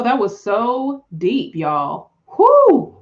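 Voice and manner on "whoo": high loud whoo; excited whoop. Real, not acted. Excited after deep reading.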